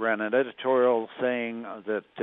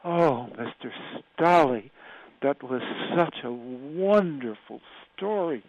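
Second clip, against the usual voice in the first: imitating an incredibly breathy voice, higher and slow; 4 semitones higher than usual. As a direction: breathe the words out slowly, a little higher. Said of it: "incredibly breathy voice".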